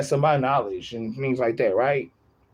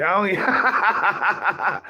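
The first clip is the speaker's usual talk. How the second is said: laughing; 6 semitones higher, much slower than usual. Real, not acted. Laughing at a comment.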